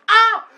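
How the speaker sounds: short, very high cry of shock; shocked scream. Retold or imitated. Friend seeing the slap land, shocked scream.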